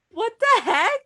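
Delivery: high squeaky what the heck; shocked. Retold or imitated. Shocked reaction quoted.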